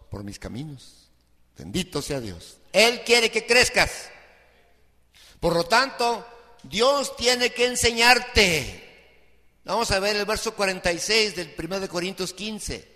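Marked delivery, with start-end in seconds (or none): 2.7-4.1 emphatic. Real, not acted. Preacher stressing God's will. 5.4-8.8 louder, slow and deliberate; preaching with force, slowly; emphatic preaching. Real, not acted. Preacher stressing the point.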